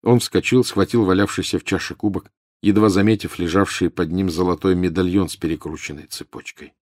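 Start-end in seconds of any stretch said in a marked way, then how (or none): none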